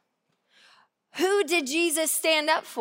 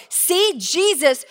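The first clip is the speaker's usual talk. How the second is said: raised, smug quote; louder mock know-it-all; mock self-righteous; 4 semitones higher, 6 dB louder than usual. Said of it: "people go".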